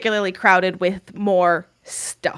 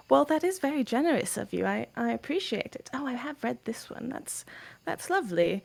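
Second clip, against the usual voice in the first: polite NPC voice; 3 semitones higher, 8 dB quieter than usual. Acted.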